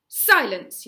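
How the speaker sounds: very loud, cutting shout; yelled command. A narrator's reading. Gobber silencing the squabbling boys, furious yell. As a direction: slam the word down like a hammer. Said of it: "yelled Gobber".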